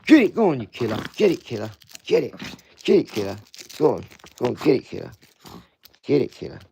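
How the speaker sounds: loud hyped-up urging; loud play cheer at the dog; egging on. Real, not acted. Egging dog to attack toy.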